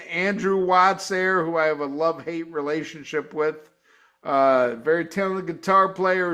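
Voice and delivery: usual speaking voice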